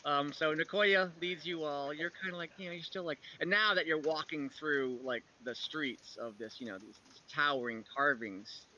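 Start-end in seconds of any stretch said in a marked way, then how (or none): none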